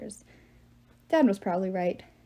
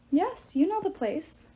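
slow, higher-pitched gloating; lightly voiced smug giant; menacing giant; 8 semitones higher than usual. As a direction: savour the villain's advantage.

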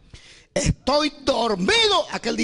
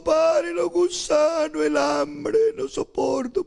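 higher, slow anguished plea breaking into sobs; crying out to God; 12 semitones higher, much slower than usual. Retold or imitated. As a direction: beg God to end it, voice cracking high. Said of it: "grité, le dije".

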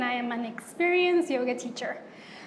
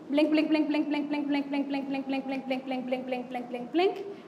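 quick, even patter, fading softer until the last word lifts; rapid rhythmic repetition; 3 dB quieter, faster than usual. Shown as a demonstration. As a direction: tick off each blink in a steady rapid stream. Counting out rapid exaggerated blinks.

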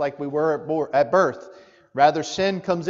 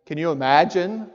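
ordinary delivery

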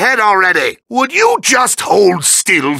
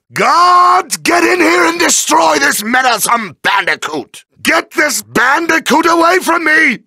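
high-pitched commanding villain shout; summoning guards; 7 semitones higher than usual. Acted. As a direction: shout for the guards in rising panic. Villain summons guards against attacker.

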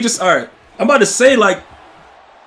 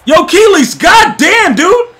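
high, loud, drawn-out "goddamn"; loud exclaiming; 11 semitones higher, 6 dB louder, slower than usual. Real, not acted. Streamer exclaiming to chat about being so sticky, loud and amazed. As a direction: holler in amazed disbelief, stretching the big words.

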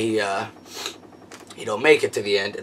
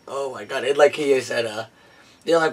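ordinary talk, nothing marked

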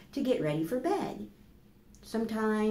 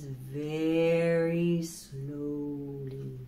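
drawn-out, much lower slow read; 6 semitones lower, much slower than usual. Acted. Teacher reading the slow turtle to children, drawn out. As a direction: stretch it out like a sleepy turtle.

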